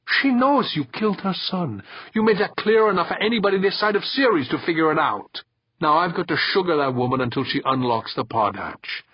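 high, quick reproach; reproachful. A narrator's reading. Boss blaming them for making his job harder, reproachful. Boss blames men for killing.

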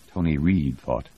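ordinary delivery, nothing marked

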